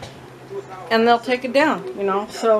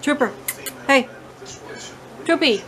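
ordinary talk

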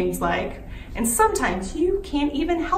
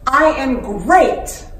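slow, loud affirmation; louder chanted affirmation; loud and powerful; 10 dB louder, much slower than usual. Shown as a demonstration. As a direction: proclaim it for the kids to repeat. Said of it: "you want everything to be loud and powerful".

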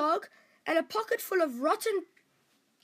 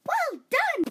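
much higher, louder cheer; delighted cry; 9 semitones higher, 4 dB louder than usual. Acted. Princess cheering Dan's throw, delighted cry. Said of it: "cried the princess".

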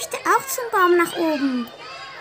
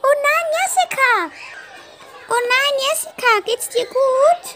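put-on alarmed child; 6 semitones higher, 3 dB louder than usual. Friend alarmed at fall.

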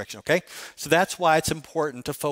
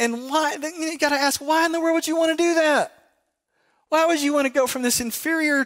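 rising indignation; 10 semitones higher than usual. Real, not acted. Preacher's rising indignant question.

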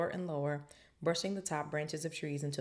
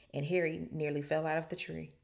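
ordinary delivery, nothing marked

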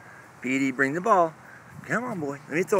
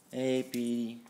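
ordinary talk, nothing marked

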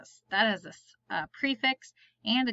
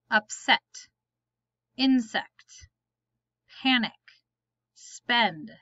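each word said alone, clear and careful, with a pause after it; word list read aloud; slower than usual. Shown as a demonstration. Teacher reading spelling words to the class, slow and careful.

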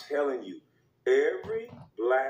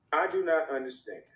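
ordinary talk

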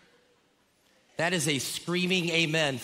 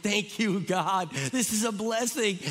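raised-pitch, gushing thanks; grateful delight; 5 semitones higher, slower than usual. Retold or imitated. Grandparents on day one of babysitting, gushing gratitude. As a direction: thank heaven with playful rapture.

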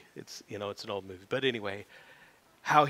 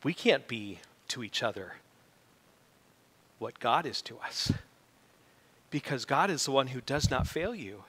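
ordinary talk

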